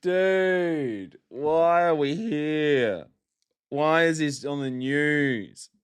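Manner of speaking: slow, high, stretched-out groan of disbelief; drawn-out exasperation. Real, not acted. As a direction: drag every word out, utterly fed up. Exasperated comment on the article.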